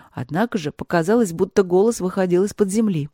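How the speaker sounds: ordinary delivery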